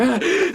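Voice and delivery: quick high gasp for breath; sharp gasp. Retold or imitated. Hold'em gasping while grilling Tama. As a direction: gasp in shock mid-sentence. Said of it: "it was like".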